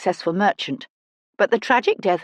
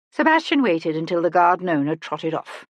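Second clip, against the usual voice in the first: ordinary delivery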